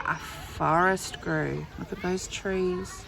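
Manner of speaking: ordinary delivery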